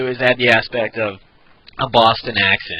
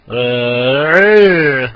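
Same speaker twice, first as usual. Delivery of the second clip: held sound sliding upward, loud; octave change; 7 dB louder than usual. Shown as a demonstration. Demonstrating the octave change for viewers, held slide. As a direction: slide up through the octave and hold it. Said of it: "octave changes, it goes from".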